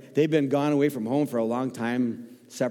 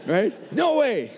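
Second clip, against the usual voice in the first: mock outrage; 9 semitones higher, much slower than usual. Mocking rivalry with Packer fans.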